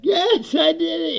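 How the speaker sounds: high, loud mock confession. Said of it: "then he's like".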